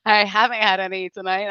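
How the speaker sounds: ordinary delivery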